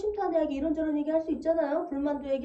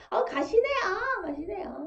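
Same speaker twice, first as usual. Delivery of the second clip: high, slow Gyeongsang "가시네야" drawn out; Gyeongsang dialect teasing; 7 semitones higher, slower than usual. Shown as a demonstration. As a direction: call her 가시네야 in a playful Gyeongsang accent. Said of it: "경상도 사투리가 보면". (높고 느리게 늘인 경상도 사투리 "가시네야")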